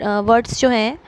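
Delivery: ordinary talk, nothing marked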